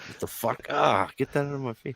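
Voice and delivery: pulling back from a bad smell; mocking a grossed-out worker; mock disgusted reaction. Guys reacting to mouse bait.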